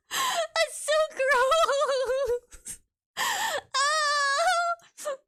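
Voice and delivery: high, slow, squeaked through laughter; laughing. Real, not acted. Cracking up at chat's joke, squeaky laugh. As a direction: crack up while saying it.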